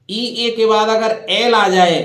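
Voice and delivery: ordinary talk, nothing marked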